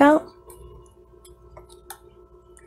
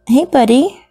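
ordinary delivery

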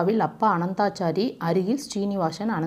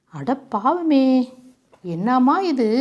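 drawn-out shocked cry, pitched higher and louder; shocked gasp; 6 semitones higher, 5 dB louder, much slower than usual. A narrator's reading. Father reading the news cutting, horrified and stunned. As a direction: let the shock stretch the first words. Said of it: "படித்தவருக்கு விதிர்த்தது".

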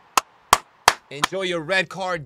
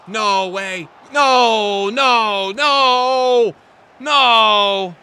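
drawn-out loud noes of despair; despairing shout; 5 semitones higher, 10 dB louder, much slower than usual. Real, not acted. Gamer watching a late goal go in, loud despairing noes. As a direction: wail it as the goal goes in.

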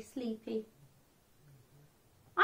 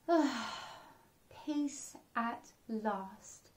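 very slow, much softer sigh of relief; relieved murmur; 6 dB quieter, much slower than usual. Acted. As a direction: breathe it out, sinking into bed. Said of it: "he said to himself".

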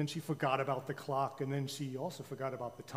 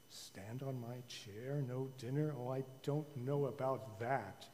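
doubtful king; 3 semitones lower, 8 dB quieter, slower than usual. A narrator's reading.

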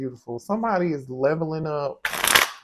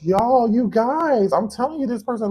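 gushing; 6 semitones higher, 4 dB louder than usual. Real, not acted. Reader gushing to the collective about their person. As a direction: pour it out to your audience, thrilled.

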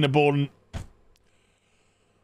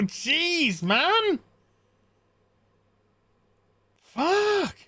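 high-pitched incredulous complaint; exasperated rant; 8 semitones higher than usual. Acted. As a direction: climb higher and higher in disbelief. Mock rant at the film's set.

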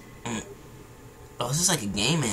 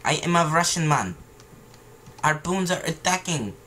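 bad Russian accent. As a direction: exclaim as a hammy Russian man. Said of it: "how to do a Russian accent".

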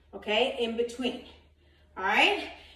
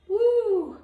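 excited whoop; 9 semitones higher, 6 dB louder than usual. Real, not acted. Coach cheers during workout.

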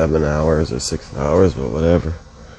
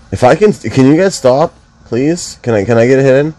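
louder, pleading over the noise; exasperated raised voice; 7 semitones higher, 5 dB louder, faster than usual. Real, not acted. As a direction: talk loudly over everyone, annoyed. Begging friends to stop talking.

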